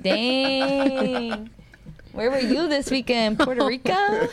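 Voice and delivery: playful put-on amazement; joking imagined remark. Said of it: "I'm like".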